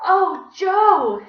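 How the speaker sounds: high, loud, delighted gush. A narrator's reading. Teacher gushing over a student's sock, high and loud. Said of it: "she gushed".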